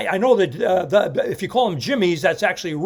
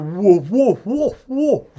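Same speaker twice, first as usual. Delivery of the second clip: dog woo; 3 semitones higher, 4 dB louder, much slower than usual. Retold or imitated. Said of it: "Jimmy Stewart".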